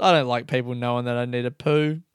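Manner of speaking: ordinary talk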